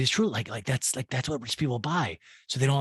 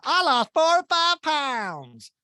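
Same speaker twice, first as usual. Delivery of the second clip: slow, high, prissy put-on voice; mock high reply; 12 semitones higher, 5 dB louder, much slower than usual. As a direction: draw it out high like a fake-modest brag. Mimicking her reply.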